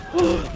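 high startled gasp; sharp excited gasp. Real, not acted. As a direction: gasp at the big moment. Sudden turn in the match.

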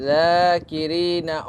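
each word drawn out slowly with long madd; elongated vowels. Shown as a demonstration. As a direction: read each word stretching the vowel one alif. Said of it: "এক আলিফ লম্বা করে পড়তেছি". (মদ টেনে ধীরে পড়া)

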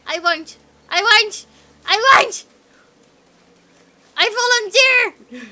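high, loud eager shouts; clamouring protest. Pretend. Player begging teammate to share the herbs, eager shouting.

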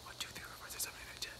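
soft whispered numbers; whispered counting. Acted. Mister Jelly whispering the count to calm himself. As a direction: count under your breath, calming down.

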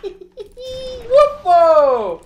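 high, loud laughing whoop; excited whoop. Real, not acted. Streamer whoops at a big win, high and loud. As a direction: let out a gleeful whoop.

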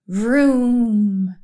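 long drawn-out engine vroom; sleeping car noise. A narrator's reading. Mister Watson dreaming of a fast car, drawn-out vroom. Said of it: "said Mister Watson in his sleep".

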